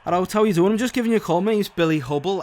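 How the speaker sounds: Liverpool. Pretend.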